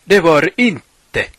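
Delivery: loud, high, drawn-out terrace yell; fan's protest shout. Shown as a demonstration. Radio host showing fans the offside protest, indignant shout. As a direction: shout it at the referee from the stands. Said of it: "måste du ropa".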